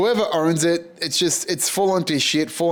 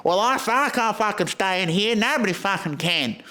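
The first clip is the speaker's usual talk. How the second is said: high, put-on crackhead complaining; 6 semitones higher than usual. Crackhead in the squat refusing to stay, put-on high voice. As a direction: whine it in a crackhead's put-on voice, pitched up. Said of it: "one of the crackheads in there".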